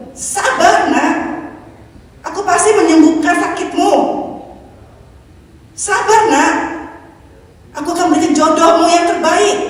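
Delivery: put-on be God urging his child to wait; God comforting. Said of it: "Tuhan bilang".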